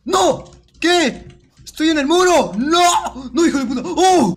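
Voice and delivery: high-pitched alarmed cries; real frantic outburst, pitched high; panicked yelling. Real, not acted. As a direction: yell in sudden panic. Stuck in wall, crocodile attacking.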